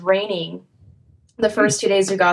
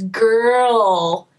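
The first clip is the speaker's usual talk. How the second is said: put-on "girl" with a long, heavy American R; mocking American accent; 2 semitones higher than usual. Australians making fun of American speech, mocking American accent. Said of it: "make fun of you and emphasize".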